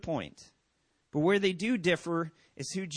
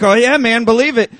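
playful boast; 5 semitones higher, 14 dB louder than usual. Retold or imitated.

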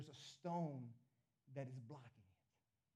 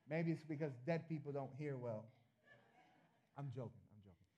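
ordinary talk, nothing marked

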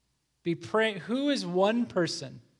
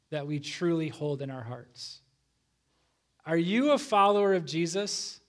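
ordinary delivery

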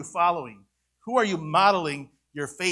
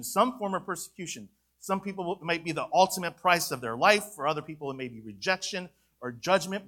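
ordinary delivery

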